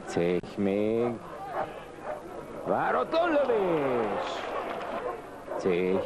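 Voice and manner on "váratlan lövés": excited. Real, not acted. Sudden shot on goal.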